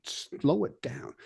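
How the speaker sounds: soft, drawn-out, near-whispered plea; hushed shushing. Retold or imitated. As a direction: shush a bandmate slowly under your breath. Signals overexcited bandmate to slow down.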